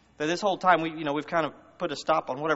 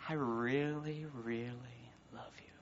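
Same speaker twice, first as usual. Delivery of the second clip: breathy loving whisper, much softer; hushed devoted whisper; tender whisper; 11 dB quieter, slower than usual. Acted. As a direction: whisper it like a secret love confession. God's tender words to listener.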